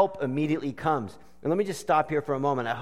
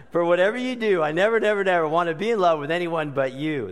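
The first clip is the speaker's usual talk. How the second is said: higher, louder gushing delivery; hammed-up lyric; 5 semitones higher, 5 dB louder, faster than usual. Preacher mocking the duet lyrics to the congregation. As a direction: belt it out in mock passion.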